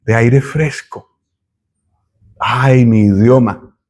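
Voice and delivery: ordinary delivery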